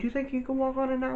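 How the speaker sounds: coach's question. Retold or imitated. Coach asking about ankle.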